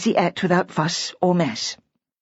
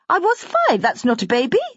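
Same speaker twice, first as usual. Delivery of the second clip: put-on much higher child voice, protesting; a small girl insisting she was big; indignant little girl; 8 semitones higher than usual. A narrator's reading. Little girl correcting Simmy's father indignantly. Lucy protests being called baby.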